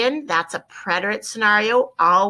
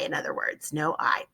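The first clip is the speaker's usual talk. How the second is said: whisper; 5 semitones lower, 8 dB quieter than usual. Real, not acted. Teacher aside after spelling.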